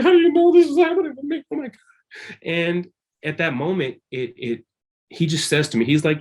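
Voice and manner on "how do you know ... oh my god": high, loud crying disbelief. Retold or imitated. To his friend, a loud, high, tearful cry of disbelief. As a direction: voice breaks high in tearful shock. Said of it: "And here I'm crying".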